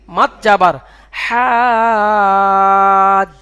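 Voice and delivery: মাত জবর হাজ with হা drawn out long; long vowel. Shown as a demonstration. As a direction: stretch the vowel in হাজ slowly. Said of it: "কিন্তু মা-, টানতে হবে।". (টেনে লম্বা করে হাজ)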